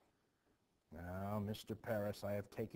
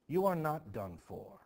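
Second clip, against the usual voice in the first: forceful reassurance; 4 semitones higher, 6 dB louder than usual. Acted. Putnam rallying the despairing minister.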